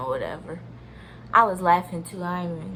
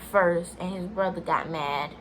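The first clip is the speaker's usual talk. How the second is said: usual speaking voice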